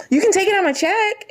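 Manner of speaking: high, loud mock plea; hammed-up last-ditch begging; desperate bargaining. Begging not to be fired.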